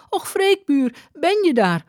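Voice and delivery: very high woman's call; crying aloud. A narrator's reading. Aagje spotting her neighbour, high-pitched cry. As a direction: call out high to someone you know. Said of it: "riep ze overluid".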